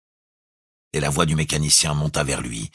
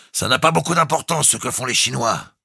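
high, raised, hurried-sounding call; hasty; 10 semitones higher, 4 dB louder than usual. A narrator's reading. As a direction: toss it off hastily in a raised voice. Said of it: "dit-il hâtivement". (appel hâtif)

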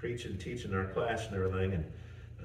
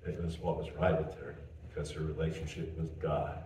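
ordinary delivery

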